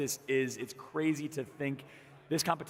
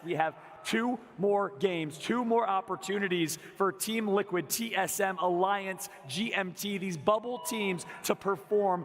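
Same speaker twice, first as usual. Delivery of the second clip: raised, excited esports caster build; hype announcer; 5 semitones higher than usual. Real, not acted. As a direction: pump up the crowd for the last games. Host teases final games.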